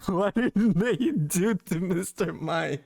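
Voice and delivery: slow, laughing through the question; laughing disbelief. Real, not acted. Streamer appalled at Mister Mime's new name, laughing disbelief. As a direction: ask it while cracking up. Cracks up at the renamed Pokemon.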